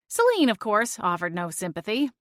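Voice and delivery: ordinary delivery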